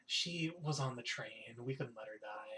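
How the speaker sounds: slow, dropping into a low whisper; hushed explanation. Acted. Short-haired woman explains to the innkeeper, hushed. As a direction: trail down to a near-whisper.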